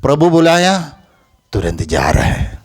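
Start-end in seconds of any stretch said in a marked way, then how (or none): none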